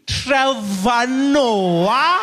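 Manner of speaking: very slow, swooping loud question; high, hammed-up disbelief; incredulous receptionist. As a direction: stretch the name out in dawning recognition. Receptionist recognizing the comedian.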